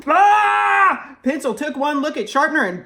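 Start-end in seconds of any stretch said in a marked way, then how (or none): 0.1-1.2 scream. Acted. Pencil sees Sharpener.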